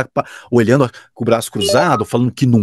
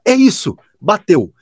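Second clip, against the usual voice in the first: much higher satisfied exclamation; client's delight; 8 semitones higher, 3 dB louder, slower than usual. Retold or imitated. Client approving the director's take, higher excited imitation. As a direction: mimic the client finding it.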